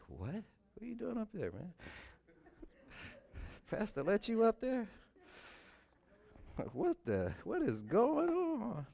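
joking friend acting stunned to see him up front, high incredulous voice; mock surprise. Surprised friend seeing him on stage.

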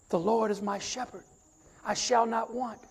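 normal talking voice

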